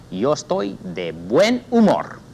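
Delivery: teacher models the line for students; slow model sentence. Shown as a demonstration. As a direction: say Yo estoy de buen humor slowly to repeat. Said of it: "Repita, amigos".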